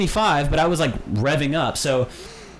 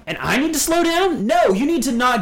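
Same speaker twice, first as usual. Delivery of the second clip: high outraged question; indignant; 8 semitones higher, 3 dB louder than usual. Real, not acted. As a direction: scoff at the idea, loudly.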